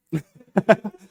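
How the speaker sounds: high, loud hyena giggle; hyena cackle. Retold or imitated. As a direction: laugh it off like a gnoll. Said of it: "and they sound like".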